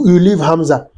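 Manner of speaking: normal talking voice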